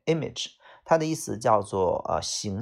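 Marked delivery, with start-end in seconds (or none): 0.1-0.5 "image" with the stress up front, IM-ij. Shown as a demonstration. Teacher repeating the word after the stress rule, "image" stressed on first syllable. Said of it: "重音在前面".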